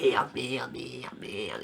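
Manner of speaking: hushed, panicky curses under the breath; low frantic mutter of merde; panicked muttered swearing. Retold or imitated. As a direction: swear softly in rising panic. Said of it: "je fais".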